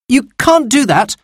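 a sister begging him to stop; crying out. Acted. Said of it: "cried Anthea".

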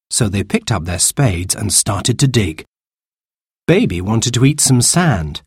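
ordinary delivery

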